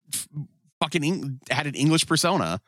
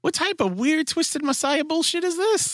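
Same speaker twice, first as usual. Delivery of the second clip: acted disbelief. Podcaster relives watching a cult scene, high and incredulous. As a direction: stretch it out way up high, utterly baffled. Acting out baffled reaction to show.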